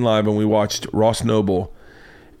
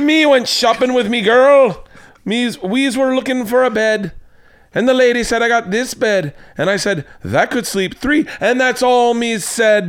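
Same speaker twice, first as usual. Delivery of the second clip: put-on Irish accent; 11 semitones higher, 3 dB louder than usual. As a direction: ham up a cartoon Irish lilt. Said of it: "I'm gonna do my Irish accent".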